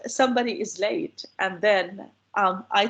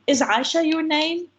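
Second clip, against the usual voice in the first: English question order, raised pitch on "Is Aisha your name"; 6 semitones higher than usual. Shown as a demonstration. Lecturer shows how English asks, question tune. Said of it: "Like in English, we say".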